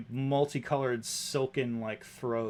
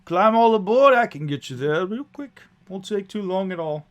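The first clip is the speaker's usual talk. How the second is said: high, loud call to board; hearty coach driver; 7 semitones higher, 9 dB louder than usual. Acted. Driver promises passengers a quick ride, hearty and loud. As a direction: hustle passengers aboard with gusto.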